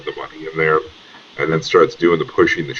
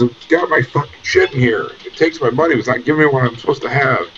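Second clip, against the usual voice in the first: agitated rant; 9 semitones higher than usual. Acted. Agitated NPC ranting at machine.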